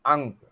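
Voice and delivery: अंग, रंग, दंग each said alone with a clear nasal ng; nasal words demo. Shown as a demonstration. Teacher drilling nasal words while students watch. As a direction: let the nose carry each word. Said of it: "मेरे नाक पे आपकी दृष्टि हो".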